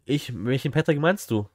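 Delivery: usual speaking voice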